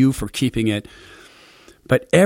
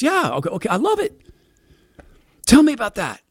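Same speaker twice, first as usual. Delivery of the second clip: much higher, eager voice; lightly voiced eager self; 12 semitones higher than usual. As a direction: push the neighbor to keep talking. Neighbor voiced excited in story.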